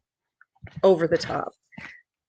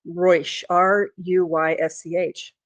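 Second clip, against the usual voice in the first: usual speaking voice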